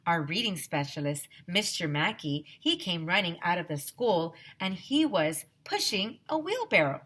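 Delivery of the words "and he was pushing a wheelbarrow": plain reading voice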